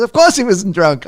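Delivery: loud, high imitated outburst; indignant retort. Coach rejecting interviewer's question about drink, indignant. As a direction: fire back like an offended coach. Angry coach answers the challenge.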